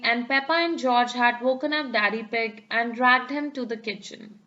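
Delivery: ordinary delivery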